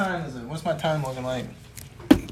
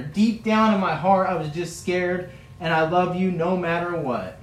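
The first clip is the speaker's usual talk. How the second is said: louder, grieved confession that slows and softens at the end; upset Peter; 2 semitones higher, 6 dB louder than usual. Acted. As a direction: pour out the guilt, then settle into love. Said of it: "Peter starts to get upset".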